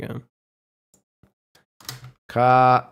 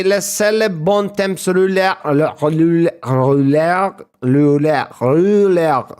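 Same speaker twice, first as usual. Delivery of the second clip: hammed-up French, rouler repeated louder and louder; mock French accent; 3 semitones higher, 4 dB louder than usual. Streamer mocking the cheers phrase, French accent. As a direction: roll rouler again and again. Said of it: "you say".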